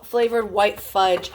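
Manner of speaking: ordinary talk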